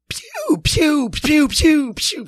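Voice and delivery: high, loud pew pew blasts; firework noises. Retold or imitated. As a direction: mouth the firework bursts. Imitating fireworks going off.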